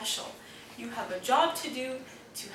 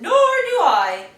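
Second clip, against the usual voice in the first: grumpy crayon; 8 semitones higher, 11 dB louder, slower than usual. Acted. Crayon complaining.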